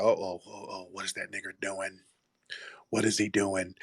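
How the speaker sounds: low, soft mock-wary murmur; suspicious hushed aside. Coworkers wondering what the quiet man is doing, hushed mock suspicion. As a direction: voice watchful coworkers under the breath.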